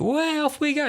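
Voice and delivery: very high, airy mock cheer; mock cheery Doctor; chirpy Doctor. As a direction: toss it off lightly. Breezy Doctor again.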